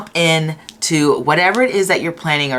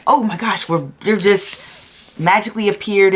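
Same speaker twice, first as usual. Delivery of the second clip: higher-pitched pretend astonishment; mock amazement; 5 semitones higher than usual. Reader pretending to pile two they magically jumped a year, mock amazement. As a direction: gasp it as a playful pretence. Acting out pretended sudden arrival.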